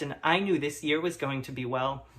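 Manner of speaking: normal talking voice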